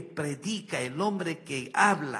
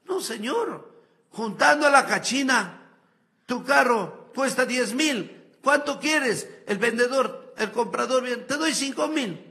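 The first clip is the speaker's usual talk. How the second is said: haggling buyers and sellers; 7 semitones higher, 5 dB louder than usual. Acted. Acted haggling over the dead man's car.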